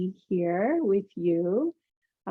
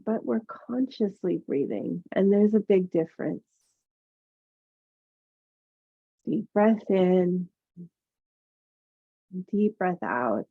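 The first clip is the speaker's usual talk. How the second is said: soothing massage murmur. Acted. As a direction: ease them into calm breathing. Yoga teacher guides breathing meditation.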